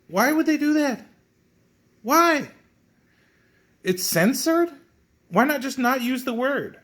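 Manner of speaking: high-pitched, incredulous questioning; exasperated disbelief. Real, not acted. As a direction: demand an explanation, voice shooting way up. Baffled by censored swear word.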